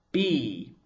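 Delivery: B said as "bee" with a long ee; long vowel demo. Shown as a demonstration. Teacher showing how hirik yod sounds, long B. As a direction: hold the ee sound in B. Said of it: "It sounds like".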